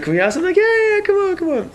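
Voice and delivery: high-pitched, excited pleading and welcome; imitated squeaky-high eagerness; eager exchange. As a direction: mimic an excited group egging each other on. Eager request and welcome, acted.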